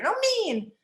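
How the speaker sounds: high, loud imitated child retort; defiant child. Boy switches on teacher, imitated loud defiance. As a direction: retort like a cross little boy. Child snapping back, acted.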